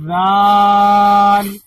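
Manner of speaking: "دال" stretched very slowly with tongue between teeth; slow model of the dh letter; long drawn dhal. Shown as a demonstration. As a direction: drag out the dh sound. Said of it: "دیکھیے میرے منہ کو".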